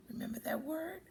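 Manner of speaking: hushed whisper; whispered question. Real, not acted. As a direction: ask it in a soft whisper. Aside question, unvoiced whisper.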